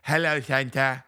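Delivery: first try at a British elf voice; British elf accent. Retold or imitated. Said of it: "He's got a British accent".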